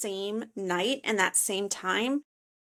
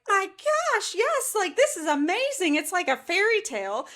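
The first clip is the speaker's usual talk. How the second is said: high-pitched, retold giddy delight; thrilled inner voice; 10 semitones higher than usual. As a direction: gush like a swept-off-her-feet dreamer, voice way up. Retells her thrilled inner reaction.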